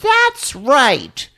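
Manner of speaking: put-on high, loud child's voice; little girl agreeing. Judy backing up Ralph, high childlike chirp. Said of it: "Judy goes".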